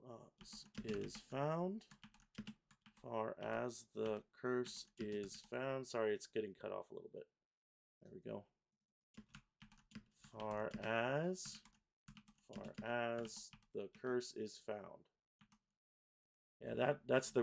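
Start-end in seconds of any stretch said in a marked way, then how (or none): 0.1-5.9 very slow, stretched words; measured lyric dictation; syllable-by-syllable reading. Shown as a demonstration. Reads lyrics while pasting syllables. 10.4-15.0 slow, separated words; drawn-out carol line; syllable-by-syllable reading. Shown as a demonstration. Reads lyrics while pasting syllables.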